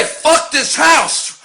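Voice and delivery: yelled title. Real, not acted. Fed-up homeowner yelling his show title, slow angry shout. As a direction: bellow it out as the last straw.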